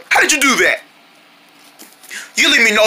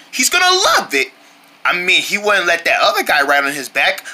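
normal talking voice

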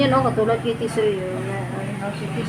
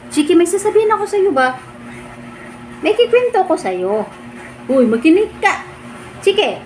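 high pet talk; 6 semitones higher than usual. Real, not acted. Calling pet to listen.

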